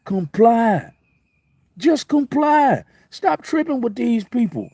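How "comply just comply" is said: high, drawn-out plea on comply; pleading emphasis. Real, not acted. Urging viewers to stop fighting police, high slow plea. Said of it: "So my point is, y'all".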